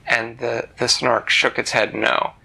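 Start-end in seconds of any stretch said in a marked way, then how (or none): none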